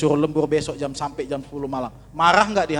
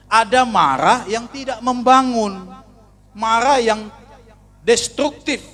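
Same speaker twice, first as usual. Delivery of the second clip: raised, louder preaching voice; preaching at full force; 7 semitones higher, 4 dB louder than usual. Acted. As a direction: drive each phrase home from the pulpit. Preacher rising on anger theme. (berkhotbah dengan suara lantang)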